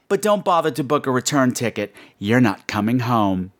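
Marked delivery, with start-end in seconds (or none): none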